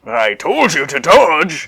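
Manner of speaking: hammy Armstrong voice. Acted. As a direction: bellow it like a big hero. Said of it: "sounds very similar to Armstrong".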